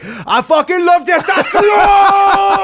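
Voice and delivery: high, slow laughing shout; mock macho yell. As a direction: bellow it with manic laughter. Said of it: "he's like".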